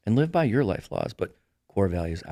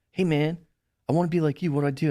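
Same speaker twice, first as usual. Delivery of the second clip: higher-pitched eager fan; lightly voiced admirer, pitched up; imitated admirer; 7 semitones higher, faster than usual. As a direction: mimic someone coming up to ask. Imitating eager admirers asking advice.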